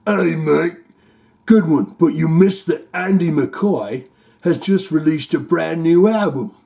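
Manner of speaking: lightly voiced Australian reader; Australian accent. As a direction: read the comment in an Aussie accent. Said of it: "I'll go say it like".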